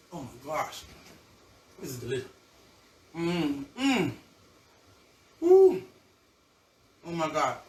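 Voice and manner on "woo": a high, loud whoop of delight; excited whoop. Real, not acted. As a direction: let out a joyful whoop at the taste. Tasting her seafood balls, thrilled.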